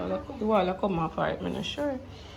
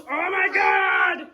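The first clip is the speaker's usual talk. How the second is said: exasperated shout; 8 semitones higher, 5 dB louder, much slower than usual. Real, not acted. Exasperated after child runs off.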